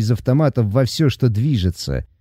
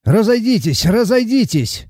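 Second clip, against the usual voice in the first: shouting; 9 semitones higher than usual. A narrator's reading. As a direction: shout to clear the way. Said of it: "кричал Миха".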